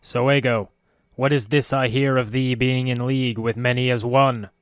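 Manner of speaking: a cold, emotionless hiss. A narrator's reading. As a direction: hiss it coldly, without feeling. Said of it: "a cold, emotionless hiss".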